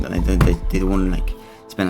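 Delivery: usual speaking voice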